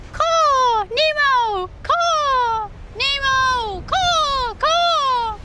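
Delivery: high drawn-out bird-like calling; high pretend mating call; yelling his name. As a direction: holler for a lost bird, each word falling. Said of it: "keep yelling his name".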